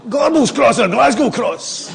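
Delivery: high, loud Glasgow ma voice; mother's voice. Retold or imitated. The dead mother answering her son, high and mocking. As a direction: play his old Glasgow ma answering back with a laugh. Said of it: "So I say".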